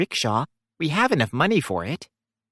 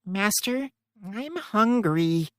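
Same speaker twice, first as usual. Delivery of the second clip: put-on slow, soft, whining child voice; whiny little girl; 4 semitones higher, 4 dB quieter, slower than usual. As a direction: drag it out like a hungry kid. Hungry child begs master.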